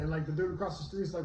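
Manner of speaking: ordinary talk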